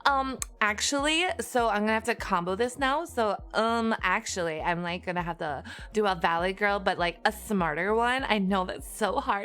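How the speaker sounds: hammed-up Valley Girl explaining to chat. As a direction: lay the Valley Girl talk on thick. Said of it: "So I talk like a Valley Girl".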